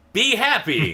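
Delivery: high-pitched burst of mock happiness; sudden forced cheer. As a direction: flip abruptly into loud, high cheerfulness. Acting out flipping happiness switch.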